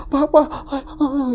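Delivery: high-pitched frightened cry, acted out; retold panicked cries. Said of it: "saya teriak-teriak". (menirukan jeritan panik)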